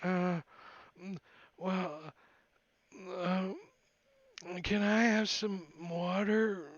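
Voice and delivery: slow, fading plea down to a whisper; barely able to speak from thirst; parched and weak. Acted. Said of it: "He's having a hard time talking".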